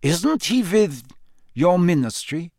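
Krum. A narrator's reading.

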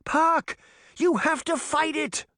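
high urgent shout; girl shouting to Puck being swallowed by the hole. A narrator's reading. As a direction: yell at him to hold on. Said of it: "Sabrina shouted".

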